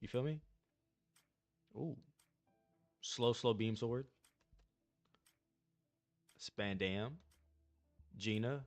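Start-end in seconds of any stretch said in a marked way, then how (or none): none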